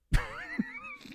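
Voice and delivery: short high-pitched giggle; a quick squeaky laugh. Real, not acted. Retelling the chat drama, short high giggle.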